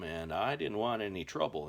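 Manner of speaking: ordinary talk, nothing marked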